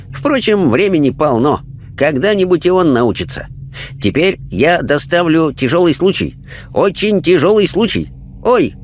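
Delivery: high, calm sage voice; Chinese spirit voice. A narrator's reading. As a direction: sum him up gently as a wise spirit.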